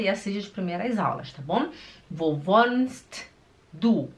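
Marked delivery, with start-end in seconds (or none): 2.1-4.1 WOHNST with a released T, then a pause before DU; slow, separated words. Shown as a demonstration. Teacher showing slow speech to learners, words separated. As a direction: spell out "wohnst", stop, then say "du".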